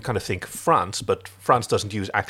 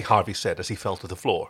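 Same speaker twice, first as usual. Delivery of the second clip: ordinary delivery, nothing marked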